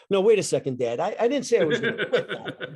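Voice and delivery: put-on a kid scrambling to take it back, half laughing; panicked protest. Son protesting to his dad about the dishwasher job, panicked and laughing.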